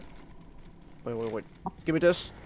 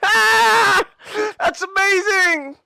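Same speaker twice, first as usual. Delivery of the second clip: excited shouting; 7 semitones higher, slower than usual. Real, not acted. Thrilled by the game scene.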